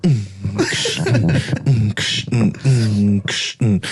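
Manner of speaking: low hum, then a hissy "ksh"; low mechanical hum-and-click imitation; imitated machine noise. Retelling the Pepsi joke, imitated noise.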